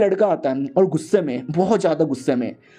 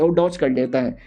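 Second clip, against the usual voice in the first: ordinary delivery, nothing marked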